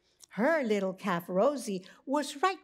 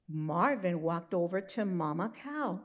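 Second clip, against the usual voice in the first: usual speaking voice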